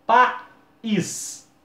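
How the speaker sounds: país, high and loud, pa-ÍS clearly apart; split into two syllables. Shown as a demonstration. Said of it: "Se eu falar, por exemplo".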